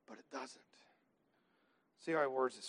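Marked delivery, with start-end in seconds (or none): 0.1-0.6 hushed whispered reassurance. Real, not acted. Preacher reassuring the fearful congregation in a whisper. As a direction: breathe it softly to calm them. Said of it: "reassuring aside".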